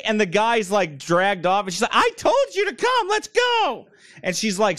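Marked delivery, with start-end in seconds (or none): 1.9-3.9 mock bossy wife. Wife ordering husband to leave, high mock nagging. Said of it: "screaming at him".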